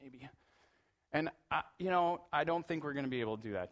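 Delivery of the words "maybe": unvoiced, noncommittal whisper; dad whispering a vague maybe to his daughter; whispered brush-off. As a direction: dodge the question under your breath. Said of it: "And I'm like".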